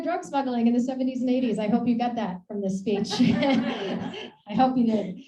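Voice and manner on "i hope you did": slow, laughing it out. Real, not acted. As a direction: chuckle through the line. Laughs at own joke to audience.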